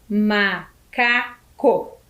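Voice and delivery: MACACO slowly split into MA, CA, CO; syllable by syllable. Shown as a demonstration. Teacher counts syllables for pupils, split syllable by syllable. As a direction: count out MACACO with a pause after each syllable. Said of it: "O macaco tem quatro sílabas?".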